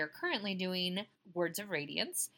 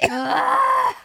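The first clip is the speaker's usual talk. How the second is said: cough and shout; 16 dB louder than usual. Real, not acted. Coughs then cries out.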